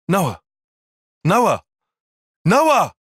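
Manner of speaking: building from a call to a high loud shout; urgent calling. Acted. Calling out to Noah, urgency building to a shout.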